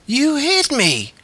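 high, slow accusing screech; outraged shriek. A narrator's reading. Stepmother to stepson who hit her chin, shrieking.

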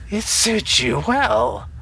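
high, slow witch voice, through a smile; smiling witch. A narrator's reading. Witch admiring the farmer with his sword, smiling witch voice. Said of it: "the witch said through a smile".

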